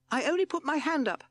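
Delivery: very high, loud toad voice; protesting toad. A narrator's reading.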